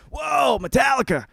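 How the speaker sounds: loud, high excited yell; big rowdy whoop; hyped-up cheering. Acted. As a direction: holler it like a thrilled metal fan. Imagined reader's excited metal cheer.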